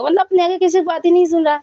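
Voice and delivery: exasperated, nearly shouting the complaint; exasperated outburst. Real, not acted.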